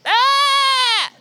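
a high, drawn-out mock bleat of a scared lamb; frightened lamb bleat. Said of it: "we start to hear this".

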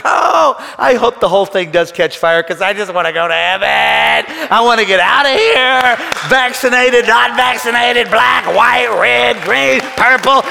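shouting triumphantly to the congregation; preaching at full force. Retold or imitated. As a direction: build to a roaring climax of faith. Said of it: "you're like".

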